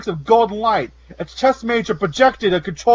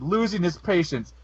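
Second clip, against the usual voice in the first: ordinary delivery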